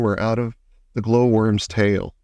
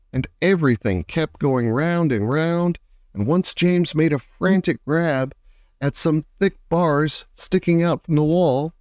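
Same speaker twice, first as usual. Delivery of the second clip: ordinary delivery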